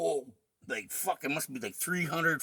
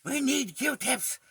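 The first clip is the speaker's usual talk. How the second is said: imitating angry mom; 7 semitones higher than usual. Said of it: "she's like".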